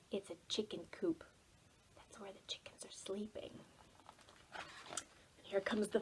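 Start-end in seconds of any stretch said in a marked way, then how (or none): 0.1-3.5 hushed whisper so chickens sleep; whisper, don't wake the hens; quick hushed storybook whisper. A narrator's reading.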